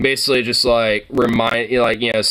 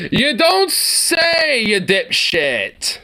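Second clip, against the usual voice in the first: slow, drawn-out sarcastic jab; mock the obvious, slowly; 10 semitones higher, much slower than usual. Real, not acted. Sarcastic retort to video.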